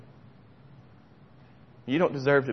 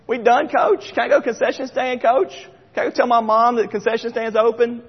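put-on high, eager five-year-old voice; little girl; 8 semitones higher, 4 dB louder than usual. Five-year-old girl begging her coach, high and eager. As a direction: pester the coach like a little kid. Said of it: "She's like".

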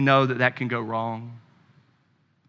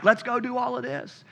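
a hint of eager children, higher and quick; excited kids; 9 semitones higher, 4 dB quieter, faster than usual. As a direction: lightly voice the kids begging dad at the door.

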